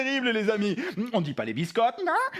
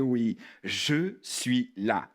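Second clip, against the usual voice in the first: low, very slow and soothing; reassuring; 9 semitones lower, much slower than usual. Pretend. Host comforts Cookie, slow low reassuring voice. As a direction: space the words out to comfort. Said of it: "sois rassuré".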